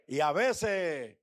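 ordinary talk, nothing marked

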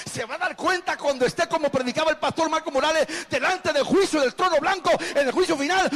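high, building to loud denunciation; full-force preaching. Real, not acted. Pastor warning a national leader of final judgment, full-force preaching. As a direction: climb in volume toward judgment.

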